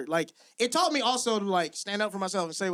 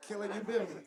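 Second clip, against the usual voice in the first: much softer echo of the punchline; hushed repeat; 10 dB quieter, faster than usual. Real, not acted. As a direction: drop the volume right down. Punchline repeated low to himself.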